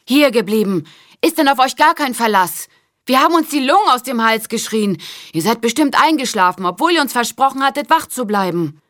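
put-on girl scolding two guardian lions, loud and commanding; imperious call. A narrator's reading. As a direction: call them to heel, commanding. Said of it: "rief herrisch".

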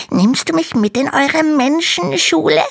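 very high, small dragon voice; little dragon voice. Acted. Little dragon complains to Hanno in a tiny high voice. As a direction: sound like a tiny, timid baby dragon. Dragon asks to come along.

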